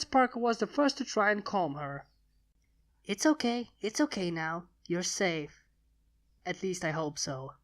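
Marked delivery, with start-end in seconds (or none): 3.1-7.5 put-on gentle reassuring voice, settling a frightened child; soothing. Twilight soothing the panicked baby dragon, gentle and reassuring.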